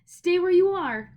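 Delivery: loud, high, slow warning cry. A narrator's reading. Boy shouting at the witch to stay back, loud warning. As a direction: shout her to a stop. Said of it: "Diggory cried".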